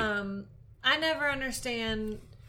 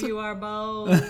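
slow, louder, laughing through it; mock declaration; 6 dB louder, slower than usual.

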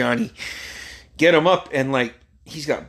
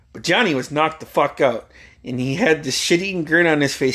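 usual speaking voice

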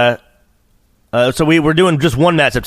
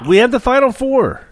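higher, bolder announcer push; committed announcement; 10 semitones higher than usual. Real, not acted. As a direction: say it like you mean it. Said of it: "Commit to it and tell us why".

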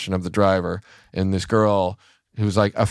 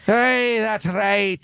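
put-on Scottish accent; 10 semitones higher, much slower than usual. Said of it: "That's a Scottish".

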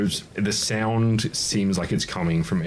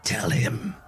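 slow taunting whisper, higher; dark whisper behind you; 6 semitones higher, much slower than usual. Acted. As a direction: tease him slowly from the dark. GM voices hidden daemon.

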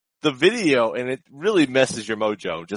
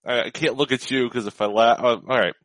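usual speaking voice

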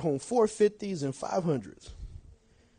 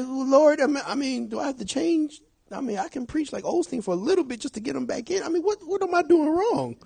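anxious self-doubt; 11 semitones higher than usual. Retold or imitated. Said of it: "you start thinking like that".

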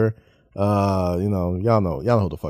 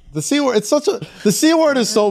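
mock shock; 11 semitones higher than usual. Real, not acted. Shocked repeat.

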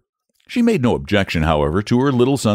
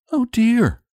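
put-on high-pitched boy in dismay; dismayed cry. A narrator's reading. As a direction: cry out as a troubled boy, without shouting. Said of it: "cried the boy".

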